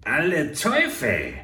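loud, slow, through the teeth; cornered oil prince cursing the ambush; grinding curse. A narrator's reading. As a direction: grit the curse out. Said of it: "knirschte der Erstere".

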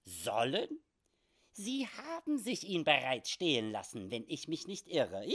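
high, swooping, teasing voice; amused little oddball; little comic frontiersman. Acted. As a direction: tease him in a quirky squeak, near laughter. Sam Hawkins teases the robbed man.

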